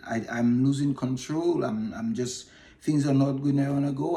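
usual speaking voice

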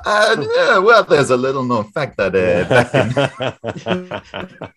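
asked by a co-host, he slips into the mailman's trivia voice; Cliff Clavin impression. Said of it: "you do a bit of a Cliff Clavin".

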